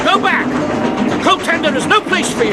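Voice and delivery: Texas-free urgent yell to stay off the coat hanger; urgent shout. Acted. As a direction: yell him back from danger. Shouting over train noise.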